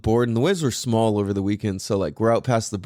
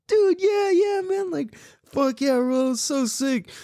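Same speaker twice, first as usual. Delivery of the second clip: hyped dude; 14 semitones higher, slower than usual. Retold or imitated. Dad retelling cheering his son on in the lineup, high hyped shout. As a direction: cheer him on like a stoked surfer buddy. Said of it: "And I'm like".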